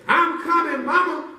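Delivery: high, eager call to mama; brave little boy. Acted. Boy shouting back to his mama. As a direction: call out like a heroic kid. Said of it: "That little boy said".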